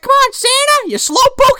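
very high, playful taunt; teasing fairy. Retold or imitated. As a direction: needle Santa to hurry. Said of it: "She's like".

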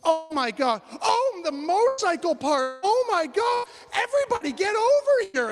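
high, loud gushing summons; excited calling out. Retold or imitated. Woman calling everyone over to see the video, excited. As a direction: imitate a thrilled woman yelling friends over.

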